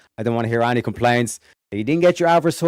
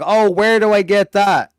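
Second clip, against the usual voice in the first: higher, louder mock eager questioner; mimicking viewers; 7 semitones higher, 5 dB louder than usual. Streamer mocking viewers who missed the hoodie, mimicking their question. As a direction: imitate clueless fans asking, raised pitch. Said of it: "you guys be like".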